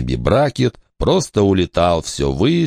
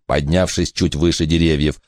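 ordinary delivery, nothing marked

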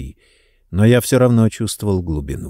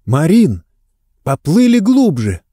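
calling out; 7 semitones higher, 4 dB louder than usual. A narrator's reading. Siri calls him down.

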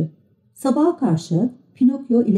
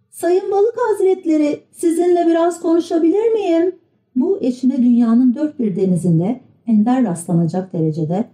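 put-on high, polite young puppet; polite puppet boy; 8 semitones higher than usual. A narrator's reading. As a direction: ask the fish respectfully. Pinokyo asks politely.

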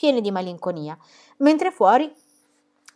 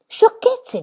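sciocchezze acutissimo e sprezzante; sprezzante Ai Shang; 6 dB louder than usual. Acted. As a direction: sbuffa come un padre severo. Ai Shang sbotta, voce acuta. (Sciocchezze detto acuto, con disprezzo)

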